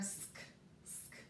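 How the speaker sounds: "sk" as a bare, soft consonant blend; isolated blend sound. Shown as a demonstration. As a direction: say just "sk", no vowel after it. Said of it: "Our S and our C".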